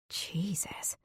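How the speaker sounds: breathless whisper. Acted. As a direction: whisper it to yourself. Whispered inner exclamation.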